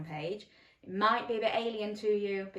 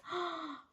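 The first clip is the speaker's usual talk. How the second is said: gasp; 10 semitones higher, 5 dB quieter than usual. Real, not acted. Delighted gasp after story.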